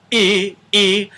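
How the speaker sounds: crashing glottal attack on e; hard onset. Shown as a demonstration. Teacher demonstrating the wrong hard attack, sung high and loud. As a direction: show the harsh, crashing onset to avoid. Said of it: "they're not crashing together like".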